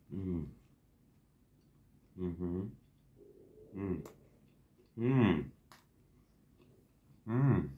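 humming while chewing food; mumbling through a mouthful; mouth full, chewing. Real, not acted. Seen 1: Bites and chews burger throughout; mouth visibly full while humming.

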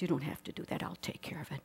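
hushed, breathy voice of God answering; soft whisper. Retold or imitated. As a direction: let it fall to a whisper. God's quiet reply, whispered.

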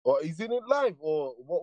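ordinary talk, nothing marked

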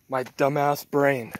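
normal talking voice

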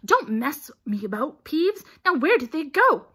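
loud, cross questioning, rising in force; impatient demand. A narrator's reading. Filch questioning Peeves on students' whereabouts, impatient. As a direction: demand an answer from a time-waster. Caretaker impatient with teasing poltergeist.

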